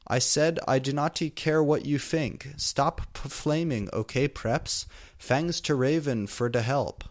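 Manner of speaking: ordinary delivery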